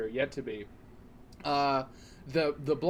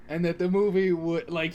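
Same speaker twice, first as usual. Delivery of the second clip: ordinary talk, nothing marked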